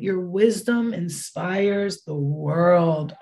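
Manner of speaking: slow, solemn card reading; reading card text. Shown as a demonstration. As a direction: read it like an oracle, slowly. Reads tarot card text aloud.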